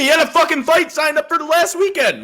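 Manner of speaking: loud, high indignant outburst; exasperated shout at cohost; heated objection. Real, not acted. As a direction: jump in shouting your disagreement. Cutting in to argue with cohost.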